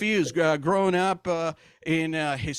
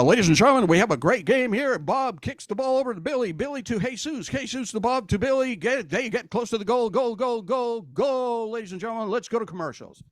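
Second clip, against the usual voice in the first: American soccer commentator; 4 semitones higher than usual. Acted. American TV announcer calls soccer, mock play-by-play voice. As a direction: call the match in a raised TV voice. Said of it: "what it sounds like on American television".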